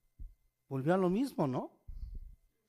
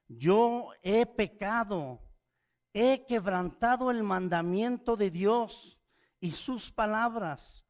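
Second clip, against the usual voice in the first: slow, loud, higher emphatic confession; emphatic words; 7 semitones higher, 5 dB louder, slower than usual. Acted. Saul confessing to Samuel, emphatic and slow. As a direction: lean hard on each word of the confession. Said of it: "palabras muy enfáticas".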